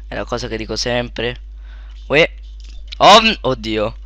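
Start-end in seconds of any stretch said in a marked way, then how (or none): none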